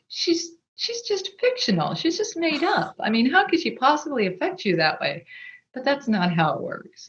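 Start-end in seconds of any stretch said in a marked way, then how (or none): 0.1-5.2 higher mock-skeptic voice, a hint of scorn. Imitating people who doubt a fictional death, higher. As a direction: lightly play a dismissive doubter. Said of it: "some people might say".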